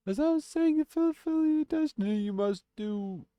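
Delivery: very high, loud wailing ghost; ghostly spirit with a message. Acted. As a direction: wail the prophecy like a high-pitched spectre.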